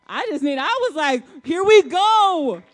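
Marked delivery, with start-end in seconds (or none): 1.5-2.6 loud, slow, soaring release of relief; joyful relief. Real, not acted. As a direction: let it burst out like a weight lifted. Relief after good news.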